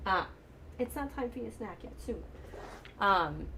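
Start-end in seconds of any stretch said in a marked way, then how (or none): 0.8-2.2 softer, higher-pitched side remark; quick hushed word away from the conversation; soft aside. Real, not acted. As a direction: gently put off a snack request under your voice. Aside to someone wanting a snack.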